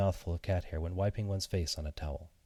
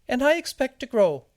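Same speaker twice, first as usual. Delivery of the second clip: tiny, very high, loud little voice; talking seed; 8 dB louder than usual. Acted. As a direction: squeak it out loudly like a tiny seed. Seed answers the cat.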